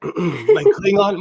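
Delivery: ordinary delivery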